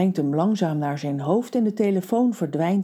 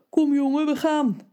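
higher, brisk giant's call; lightly voiced giant; 9 semitones higher than usual. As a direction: hurry the boy along. Giant urges boy along.

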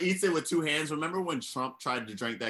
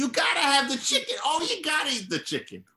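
Italian-American impression; 12 semitones higher than usual. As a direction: coax him like a pushy Italian-American. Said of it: "this impression right here".

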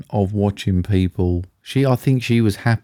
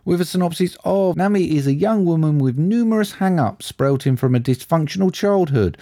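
higher-pitched, measured synopsis reading; film listing read-out; 7 semitones higher than usual. Acted. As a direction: read out the film blurb like a presenter, building to the title.

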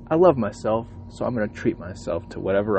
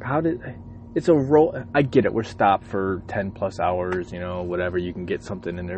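ordinary delivery

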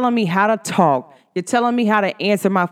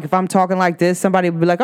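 ordinary delivery

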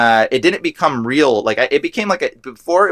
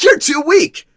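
high, jeering demon voice; taunting demon. Retold or imitated. Demon belittling the man praying, high taunt. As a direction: throw it high, like a mocking demon. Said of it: "the demon spoke through the man".

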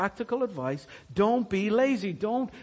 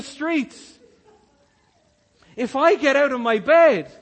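higher, louder fake terror about lions; mock panic; 5 semitones higher, 5 dB louder than usual. Sluggard making up the lion excuse, hammed-up panic. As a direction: act the frantic excuse, eyes wide.

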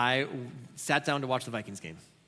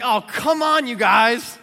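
much higher and louder, scolding the team; frustrated fan; 8 semitones higher, 12 dB louder, much slower than usual. Retold or imitated. Pastor scolding the Vikings on screen, frustrated. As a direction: groan at the team's penalties. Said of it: "'Cause I was like".

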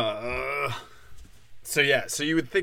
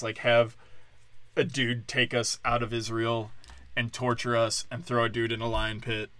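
normal talking voice

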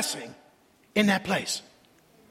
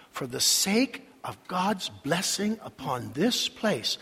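usual speaking voice

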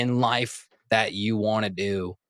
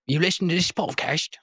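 high, botched Australian turning British; accidental British accent; 7 semitones higher than usual. Acted. Host imitating an Australian listener slips into a bad British accent. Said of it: "and then I went British".

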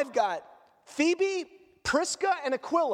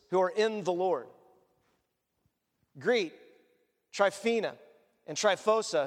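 lower, measured reading of the greetings; scripture reading; 6 semitones lower than usual. Acted. Reads scripture aloud.

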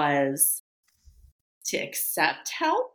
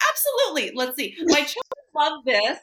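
eager acceptance; 7 semitones higher than usual. Retold or imitated. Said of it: "I'm like".

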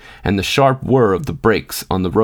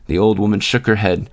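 plain reading voice

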